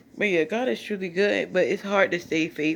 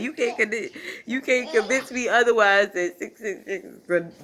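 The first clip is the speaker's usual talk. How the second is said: usual speaking voice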